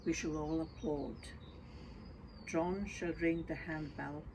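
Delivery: ordinary talk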